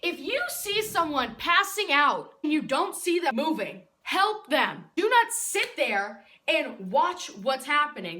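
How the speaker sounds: emphatic scolding. Real, not acted. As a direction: drive the lesson home forcefully. Emphatic life lesson to viewers.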